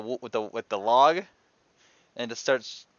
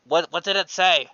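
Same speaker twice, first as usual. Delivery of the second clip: calling up; 6 semitones higher, 6 dB louder than usual. Acted. Calling up to the crow's nest.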